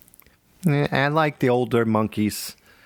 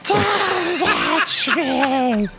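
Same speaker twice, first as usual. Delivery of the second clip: very high, dragged-out garbled mock scream; sleepy dream scream; much slower than usual. Shown as a demonstration. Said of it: "You're screaming in your dreams like this".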